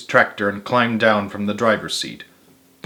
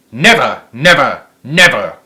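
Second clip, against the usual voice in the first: louder, each never hammered; insistent cry; 5 dB louder than usual. A narrator's reading. Boggis vows to the others, louder on each never. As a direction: refuse point-blank. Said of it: "cried Boggis".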